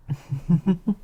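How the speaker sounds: light, teasing high laugh; a coy little laugh at him; flirty laugh. Acted. Succubus teases gawking mortal.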